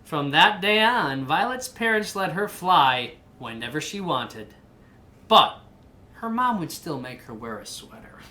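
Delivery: lively picture-book narration; reading to a child. A narrator's reading. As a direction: read the story aloud to your kid.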